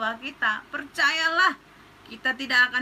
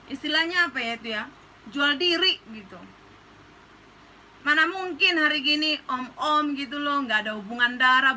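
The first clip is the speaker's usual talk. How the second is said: normal talking voice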